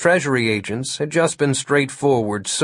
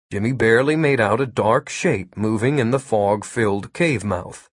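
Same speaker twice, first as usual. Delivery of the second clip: usual speaking voice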